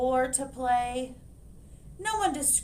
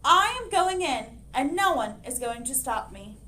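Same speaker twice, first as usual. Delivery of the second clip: determined hen; 2 semitones higher, 6 dB louder than usual. Acted. Hen declaring she will go into the library, loudly. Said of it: "she announced".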